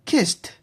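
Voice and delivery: Kissed said as kist, ending on a T. Shown as a demonstration. Student demonstrating kissed with a T ending. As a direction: stress the final T of Kissed. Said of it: "kissed with a T pronunciation".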